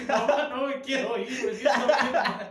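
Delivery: loud, high, laughing whine to mom; mock drunk whine. Retelling crying drunk to his mom in the bathroom, laughing mock whine. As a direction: ham up a drunk kid begging mom. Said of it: "así".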